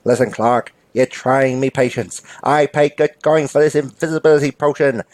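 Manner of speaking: put-on accented crook making threats; impatient bank robber. Robber losing patience with bank clerk, comic accented menace.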